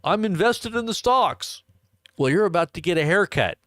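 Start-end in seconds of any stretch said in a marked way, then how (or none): 0.0-1.6 high-pitched mock boast; mock investors. Host mocking people invested in stocks, high-pitched boastful imitation. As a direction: mimic naive investors in a raised voice. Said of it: "People are like".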